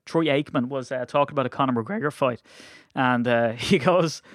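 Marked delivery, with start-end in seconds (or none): none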